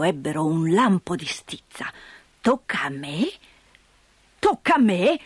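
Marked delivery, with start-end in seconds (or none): 2.4-3.3 low, quiet disbelieving question; first low grumbled echo; low incredulous mutter. Acted. Grandfather repeats, disbelief. 4.4-5.2 indignant repeat. Acted. Grandfather repeats, rising irritation.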